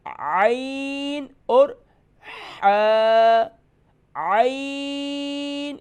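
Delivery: عین stretched very long and slow, held at a high pitch; recitation-style long عین, held very slowly; drawn-out letters. Shown as a demonstration. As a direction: hold the vowel of عین for five counts. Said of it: "عین اور حمزہ کے sound میں فرق کریں".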